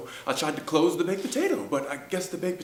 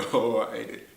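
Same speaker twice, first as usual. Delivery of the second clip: laughing; 5 semitones lower than usual. Real, not acted. Laughs recalling eating cake.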